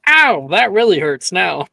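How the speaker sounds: exaggerated hurt protest; mock pained yelp. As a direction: yelp as if just smacked, playfully hammed up. Acted pain after forehead smack.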